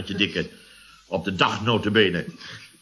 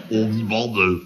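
lightly mimicked hypnotist, lower and drawn out; sombre hypnotist; 4 semitones lower, much slower than usual. Patient quoting his therapist's command, in a mildly sombre drone. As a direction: intone it like a hypnotist. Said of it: "begeleid door een somber".

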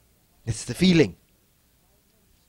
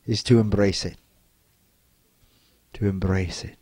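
guided meditation; 7 semitones lower than usual. Acted. Leads an inner embracing exercise.

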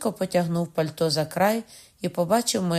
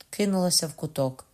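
ordinary delivery

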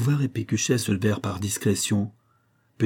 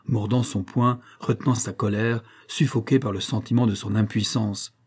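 ordinary delivery, nothing marked

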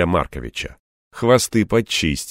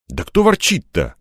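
higher, mildly indignant retort; 7 semitones higher than usual. A narrator's reading. Man denies grumbling to his assistant, mildly indignant. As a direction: protest the accusation. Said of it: "возмутился я".

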